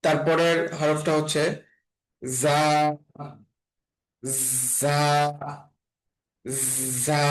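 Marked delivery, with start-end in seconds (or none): 2.4-7.2 জা with a long whistled "zzz" onset; sibilant, drawn-out "zzaa" for জা; whistling z. Shown as a demonstration. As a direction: buzz into জা like a whistle. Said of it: "শীষ দেওয়ার মতো উচ্চারণ হবে".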